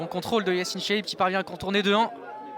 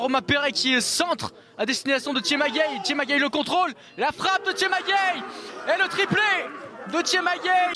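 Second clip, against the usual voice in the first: excited goal call; 6 semitones higher than usual. Real, not acted. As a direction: shout the hat-trick. Hat-trick goal called.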